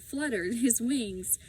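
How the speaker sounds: usual speaking voice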